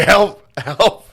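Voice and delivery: high, loud hammed-up plea; mock cry for help. Teasing friend voicing him as a panicked coward, high squeal. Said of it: "He was like".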